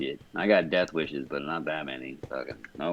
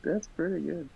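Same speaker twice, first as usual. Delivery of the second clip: impressed surprise; 14 semitones higher, 5 dB louder than usual. Real, not acted. Impressed star fruit is real.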